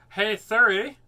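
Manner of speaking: loud clear call to Siri. Real, not acted. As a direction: raise the voice for the phone. Talking to Siri.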